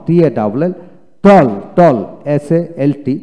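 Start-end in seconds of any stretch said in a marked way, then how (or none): 1.2-2.0 টল loud and distinct, twice; model reading. Shown as a demonstration. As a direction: drill টল loudly with an open "aw". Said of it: "আমি এখন পড়ে শোনাই".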